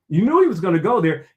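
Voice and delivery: ordinary talk, nothing marked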